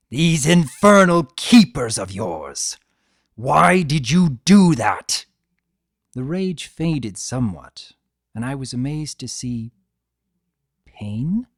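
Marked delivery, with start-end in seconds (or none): 0.1-5.3 loud, high, furious accusation; slow, loud outrage; raging. A narrator's reading. Discord raging on. 10.8-11.4 whispered wonder. A narrator's reading. Wondering, unvoiced.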